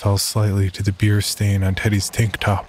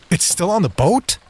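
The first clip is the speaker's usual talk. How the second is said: high, alarmed question; suddenly alarmed; 10 semitones higher than usual. Acted. Doctor realizes shark left aboard.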